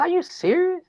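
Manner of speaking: can't believe what he hears; disbelief. Retold or imitated. Father to daughter on the phone, high incredulous cry.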